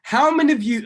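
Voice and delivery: real excitement bubbling up mid-question; getting excited. Real, not acted. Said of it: "I'm getting excited".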